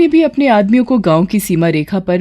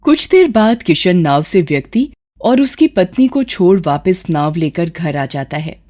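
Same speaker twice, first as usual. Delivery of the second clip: ordinary talk, nothing marked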